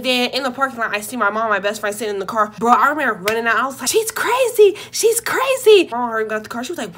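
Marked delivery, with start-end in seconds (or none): 3.9-5.9 high, loud frantic yell; panicked kid yelling she's crazy. Retold or imitated. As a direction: shout it while running away. Yelling while fleeing grandma.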